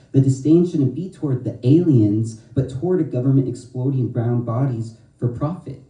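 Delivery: strong, rhythmic slam poetry delivery; spoken word poetry. Acted. Poet performing her poem to an audience, rhythmic and forceful.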